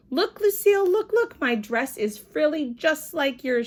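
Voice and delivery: high, loud look look; excited. A narrator's reading. May showing Lucille her matching dress, high and eager.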